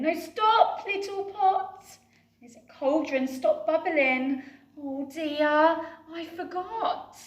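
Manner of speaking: high, loud panicked pleading; frantic little witch losing control; panicking. Acted. Betty begging the overflowing pot to stop, high panic.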